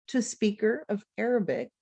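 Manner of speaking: normal talking voice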